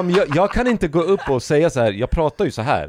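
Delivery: high, loud, laughing defence; laughing protest. Retold or imitated. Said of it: "Jag bara, jag bara". (skrattande protest)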